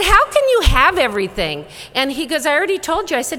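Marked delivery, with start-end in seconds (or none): 0.0-1.6 loud, high-pitched mock exasperation; exasperated disbelief. Teacher to the patient who claims every disease, exasperated. 2.4-3.2 indignant insistence. Retold or imitated. Patient argues back at teacher.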